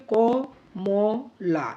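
ক, ম, লা as three separate beats; separate syllables. Shown as a demonstration. Teacher shows words can be separated, ক, ম, লা syllable by syllable. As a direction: give each of ক, ম, লা its own space. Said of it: "আলাদা আলাদাও করতে পারি".

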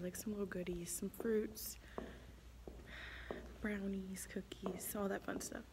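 soft whisper, barely voiced. Real, not acted. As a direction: hush it while setting up. Whispers while setting up set.

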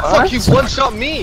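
sudden high angry yell; frustrated outburst. Real, not acted. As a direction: yell after being killed. Outburst after dying.

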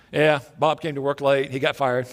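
terse, just-the-facts husband; man of few words. Acted. Husband telling his wife about work, terse and matter-of-fact. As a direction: report it low-key in a monotone. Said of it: "you know how men are when they give you the details".

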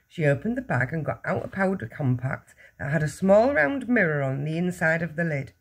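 usual speaking voice

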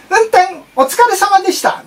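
sweet automated greeting; car navigation voice. Retold or imitated. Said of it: "言ってくる".

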